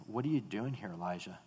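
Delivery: soft, low voice of God; quiet voice. A narrator's reading. God asking Elijah, soft and low. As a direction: ask it almost in a hush. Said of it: "a voice came to him and said".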